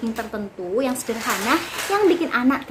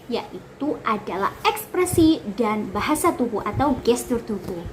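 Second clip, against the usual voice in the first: ordinary talk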